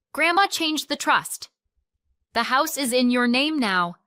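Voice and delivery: high, loud, heated outburst; angry accusation. A narrator's reading. Sister's angry accusation on the phone.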